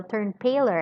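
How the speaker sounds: plain reading voice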